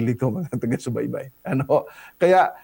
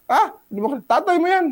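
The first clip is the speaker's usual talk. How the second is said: much higher amused mother voice rising to the end; mother's reply; 14 semitones higher, much faster than usual. Retold or imitated. Pastor voicing the mother at the door, high amused reply. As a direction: play the mom correcting her child, pitched way up. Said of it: "yung nanay naman lumabas".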